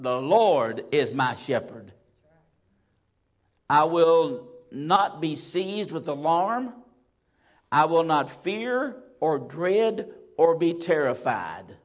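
loud, slow scripture proclamation; thundering sermon quote; full-force preaching. Acted. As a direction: ring out each line slowly.

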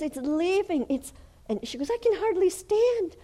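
high, loud ecstatic cry; a high, overwhelmed cry; crying-out woman. Retold or imitated. Voicing the healed woman crying out.

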